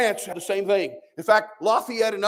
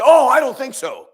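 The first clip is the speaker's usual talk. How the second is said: higher, louder mocking voice of a doubter; mock objector; 6 semitones higher, 8 dB louder than usual. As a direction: scoff like the naive listener. You say.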